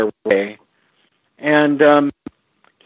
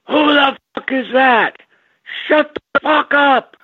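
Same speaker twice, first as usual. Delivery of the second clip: Basehart yelling out; 13 semitones higher than usual. Retold or imitated. Actor interrupted mid-shoot by a growling stomach, yelling out. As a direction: yell it out furiously, pitched way up. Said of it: "Basehart yells out".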